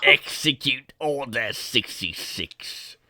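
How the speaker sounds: slow, drawn-out evil command; very slow villain voice; sinister emperor. Acted. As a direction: give the order like a Sith Lord savouring it. Trading Palpatine impressions.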